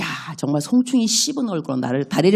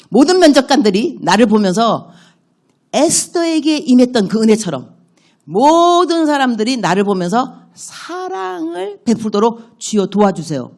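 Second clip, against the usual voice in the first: rising, pleading prayer building louder; fervent prayer; 4 semitones higher, 3 dB louder than usual. Shown as a demonstration. 면접을 앞두고 하나님께 은혜를 구하며, 간절한 기도. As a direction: beg God fervently for favor. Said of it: "이렇게만 기도할 수 있는 거죠".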